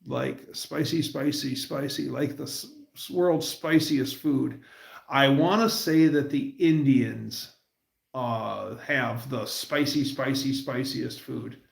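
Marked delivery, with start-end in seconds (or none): none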